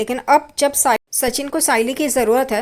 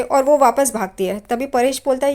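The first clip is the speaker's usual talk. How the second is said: ordinary talk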